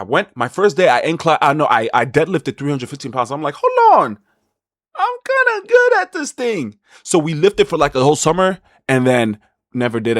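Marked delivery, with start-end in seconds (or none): 3.6-6.8 much higher, slowed put-on voice of amazement; mock surprised self. Discovering deadlift talent, high slow mock surprise. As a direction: play dumb shock at your own strength.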